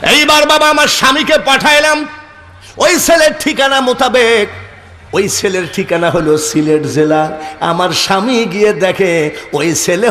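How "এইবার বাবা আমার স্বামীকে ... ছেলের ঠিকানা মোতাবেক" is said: excited telling. Retold or imitated. Mother recounting excitedly.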